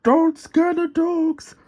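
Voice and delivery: high, slow worried crane voice. Acted. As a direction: fret over it, up high. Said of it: "worried Lofty".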